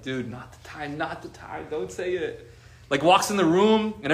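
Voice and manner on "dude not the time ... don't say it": hushed warning. Retold or imitated. Warning a friend off a joke at a funeral, hushed. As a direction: imitate discreetly stopping a tactless friend, much softer.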